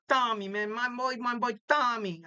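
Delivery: put-on playful Italian-American mobster accent. Podcaster plugs his boy Tommy's show, joking Italian accent. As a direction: slip into a hammy Italian accent. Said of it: "I have this Italian accent".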